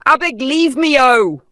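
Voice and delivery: high, loud brush-off; dismissive outburst. A narrator's reading. Vanessa dismissing the old woman, loud and annoyed. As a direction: wave her away in annoyance.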